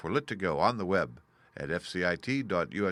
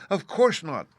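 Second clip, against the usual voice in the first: Dorothy's answer; 8 semitones higher, 8 dB louder than usual. A narrator's reading. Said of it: "answered Dorothy".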